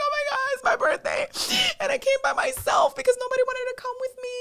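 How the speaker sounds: high-pitched, loud sobbing complaint; imitated bawling. Birthday girl out alone confiding in her idol, bawling. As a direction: mimic a fan wailing through tears. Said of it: "she just starts bawling".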